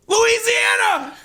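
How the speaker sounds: high, drawn-out victory yell; elated shout. Real, not acted. Gambler seeing his Little League team won, elated shout. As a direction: scream it out in triumph.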